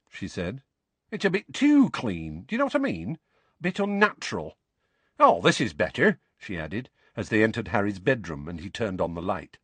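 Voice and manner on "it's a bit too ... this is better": put-on high-pitched, lilting young witch voice; chatty young witch. Young witch looking round Harry's house, chatty and high. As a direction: chat away in a high, curious voice.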